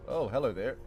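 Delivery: ordinary talk, nothing marked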